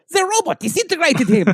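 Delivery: high-pitched comic alarm; Nightcrawler. Acted. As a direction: announce it in mock dismay. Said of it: "and Nightcrawler goes".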